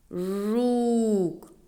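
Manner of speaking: "жук" with a long, held у; slow sounding-out of "жук", vowel sustained; drawn-out vowel. Shown as a demonstration. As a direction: stretch the у in "жук". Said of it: "Промовимо слово".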